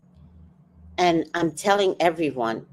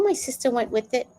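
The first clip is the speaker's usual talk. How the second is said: ordinary delivery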